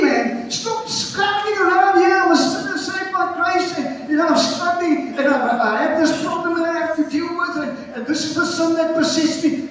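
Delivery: high, insistent pulpit shouting; full-force preaching. Real, not acted. Preacher telling congregation to give up old identity, full-force preaching. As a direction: scold the excuses at high pitch.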